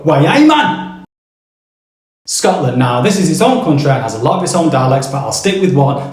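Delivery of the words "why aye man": slow, high Geordie greeting; Geordie accent. Shown as a demonstration. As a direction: stretch it out like a Newcastle local. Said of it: "Geordies, and they will go".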